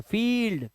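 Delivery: फील्ड as a slow "feeeld"; long vowel. Shown as a demonstration. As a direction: draw out the "ee" in फील्ड. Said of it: "ये ई है".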